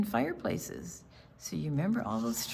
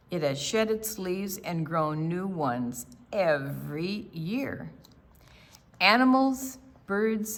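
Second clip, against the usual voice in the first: clear, steady storybook reading, a bit louder; story narration; 4 dB louder, slower than usual. A narrator's reading. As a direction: read the picture book to the class. Read-aloud story narration.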